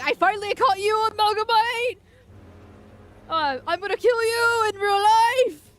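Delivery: hammed-up threat, high and loud all through; Sam Hyde. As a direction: shout it as an over-the-top mock call-out. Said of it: "I read it like that".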